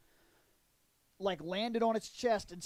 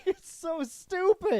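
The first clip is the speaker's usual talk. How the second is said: high, slow, laughing disbelief; 9 semitones higher, 4 dB louder, slower than usual. Real, not acted. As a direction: giggle it out in disbelief. Laughing at the scene.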